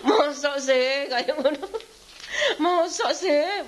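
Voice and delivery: a husband teased, stretched and incredulous; mock disbelief. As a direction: drag it out in amused disbelief. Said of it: "Bojoku ngomong ngono".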